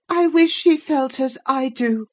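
put-on high, loud boy's complaint; aggrieved boy. A narrator's reading. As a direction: complain resentfully as the wronged boy. Boy complains after coughing fit.

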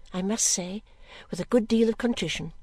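ordinary delivery, nothing marked

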